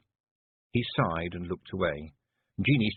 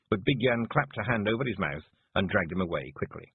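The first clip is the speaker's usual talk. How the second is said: usual speaking voice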